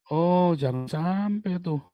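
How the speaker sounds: usual speaking voice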